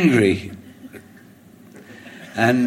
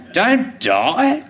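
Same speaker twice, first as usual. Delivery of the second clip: higher barked command; mock order; 11 semitones higher than usual. Wife heckling from the crowd, hammed-up command. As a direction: order him not to die. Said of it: "like an order".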